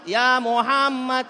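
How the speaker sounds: slow booming address; solemn call. Acted. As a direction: call out with divine authority. God's call, slow and loud.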